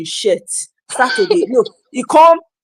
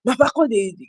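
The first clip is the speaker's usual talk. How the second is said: ordinary delivery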